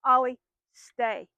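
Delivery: slow, firm stay command; firm, slow demo of the stay command. Shown as a demonstration. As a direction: give the order slowly and firmly. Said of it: "in a firm tone".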